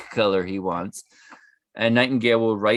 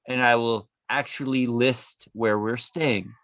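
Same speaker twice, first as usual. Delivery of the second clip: ordinary delivery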